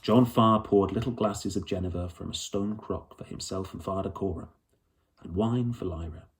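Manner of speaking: plain reading voice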